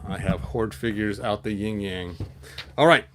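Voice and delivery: ordinary delivery, nothing marked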